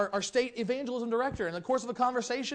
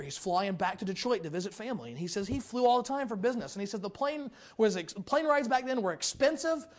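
normal talking voice

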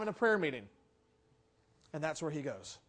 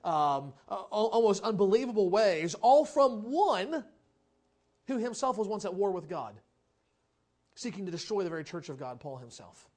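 ordinary talk, nothing marked

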